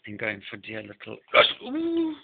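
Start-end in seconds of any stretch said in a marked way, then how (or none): 1.6-2.1 high squeal as the cat nearly grabs the snake; a sudden, high-pitched ooh of alarm that turns to relief; startled whoop. Real, not acted.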